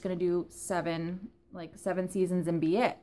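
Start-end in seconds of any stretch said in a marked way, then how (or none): none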